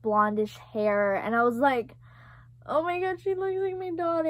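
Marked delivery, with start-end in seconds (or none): 2.7-4.3 high, soft mock-tearful voice; imitated tearful crying. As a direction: act out bursting into tears. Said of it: "I just started crying".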